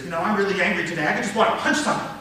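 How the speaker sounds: fast anger rising at the end; heated demo of believable anger; convincingly angry. Shown as a demonstration. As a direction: fire it off angrily, climbing to a peak. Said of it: "Now you believe me. Now maybe you're afraid of me".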